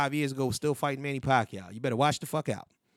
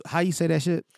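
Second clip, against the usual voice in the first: ordinary talk, nothing marked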